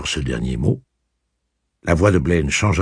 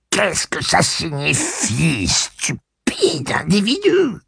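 whiny offended voice; 14 semitones higher, much slower than usual. Acted. Blaine offended, whining.

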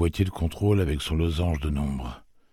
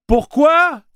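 very high loud scream of a question; 11 dB louder than usual. Acted. Susanna screams at Blaine: very high loud cry. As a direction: scream the why at the machine. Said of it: "cria Susanna".

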